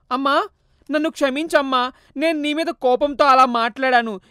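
earnest remorseful plea; pleading son. Acted. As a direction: son crying out to his mother. Son begs mother's forgiveness.

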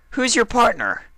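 very high, loud accusing question; demanding Dash. A narrator's reading. Dash demanding who Applejack's partner is. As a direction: demand an answer, hurt.

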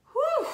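high loud whew of relief; relieved whew. Acted. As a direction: puff out big relief. Relief after escape.

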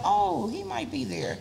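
high, slow musing; lightly dreamy self-quote; airy recalled thought. Retold or imitated. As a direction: float it like a hopeful afterthought. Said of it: "and I was like".

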